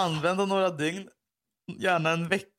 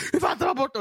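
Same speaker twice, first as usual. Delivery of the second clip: high-pitched panicked outburst; återgiven panik; 12 semitones higher, 3 dB louder, faster than usual. Retold or imitated. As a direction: blurt it out as if panicking. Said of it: "en panik". (panikslaget, högt tonläge)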